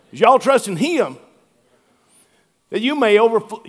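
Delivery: "him" drawn into two syllables, Southern; Southern accent. Retold or imitated. Pastor rereading the verse with Southern "him". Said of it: "him is not a one-syllable word".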